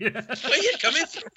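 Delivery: put-on laughing, high parody of a failed Welsh accent; mocking accent imitation. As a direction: echo the botched Welsh accent through laughter. Joins mocking the attempted accent.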